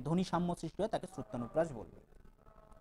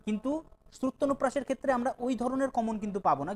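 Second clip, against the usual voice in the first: ordinary delivery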